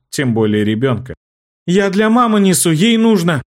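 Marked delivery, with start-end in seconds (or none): 1.6-3.4 high, loud frightened insistence; exclaiming. Acted. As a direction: stand your ground, loud and high. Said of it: "воскликнул мальчишка".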